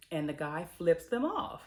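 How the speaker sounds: normal talking voice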